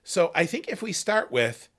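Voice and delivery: ordinary talk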